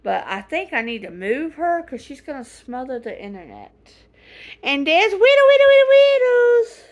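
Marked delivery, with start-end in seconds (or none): 4.6-6.8 much higher, louder squeal; excited calling. Real, not acted. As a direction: squeal the pet's name in delight. Calling a pet, voice rising.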